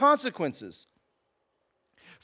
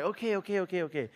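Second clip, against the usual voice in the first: resigned okay; 3 semitones lower, 8 dB quieter, much faster than usual. Retold or imitated. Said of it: "I'm like".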